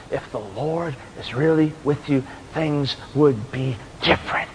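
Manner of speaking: low, hushed, measured words; God speaking gently. Retold or imitated. God answering Gideon's challenge, low and quiet. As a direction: let it sink in, soft and low. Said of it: "God's saying to Gideon".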